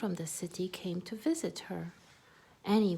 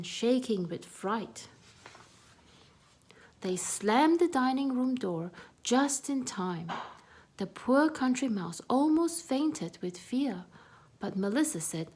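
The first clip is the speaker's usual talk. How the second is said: plain reading voice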